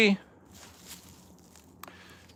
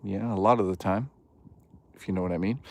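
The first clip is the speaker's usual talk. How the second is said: sly low aside; 6 semitones lower, 7 dB quieter, faster than usual. Pretend.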